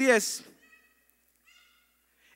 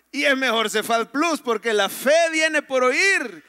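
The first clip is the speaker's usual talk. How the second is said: loud, higher, drawn-out proclamation; emphatic preaching; 4 semitones higher, 6 dB louder than usual. Real, not acted. Pastor cheering the church app to the congregation, emphatic preaching. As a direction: drive it home to the congregation, slowly and loudly.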